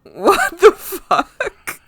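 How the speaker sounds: loud, high, slow stunned outburst; shocked disbelief. Retold or imitated. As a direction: drag it out, utterly baffled. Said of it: "and I was like".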